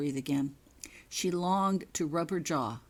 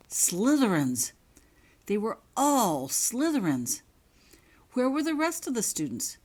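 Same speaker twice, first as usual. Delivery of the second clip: alarmed realization; 6 semitones higher, 5 dB louder than usual. A narrator's reading.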